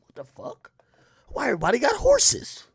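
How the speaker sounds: hushed then high disbelief; baffled at all the horses; bewildered. Retold or imitated. Driving past farms, baffled whisper rising to high question.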